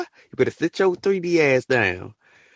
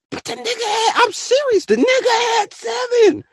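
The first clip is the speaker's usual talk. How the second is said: incredulous high shout. Real, not acted. Disbelieving rant about seven wives.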